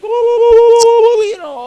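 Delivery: high, loud falsetto woo-woo whoops; screaming woo whoops over music; whooping cheer. Acted. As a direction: whoop it up like a wild party crowd. Mock whooping celebration.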